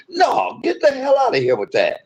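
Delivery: high, scornful get out; brushing off being made the villain; dismissive anger. Real, not acted. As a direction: wave it off with contempt. Rejecting an accusation.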